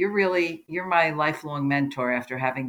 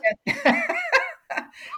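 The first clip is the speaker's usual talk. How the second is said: long high burst of laughter; real amused laugh. Real, not acted. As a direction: laugh freely at the joke. Shared laughter over German.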